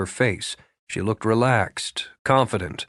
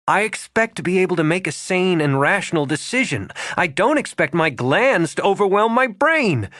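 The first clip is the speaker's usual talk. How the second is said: exasperated outburst; 8 semitones higher than usual. A narrator's reading. Regan exasperated at herself.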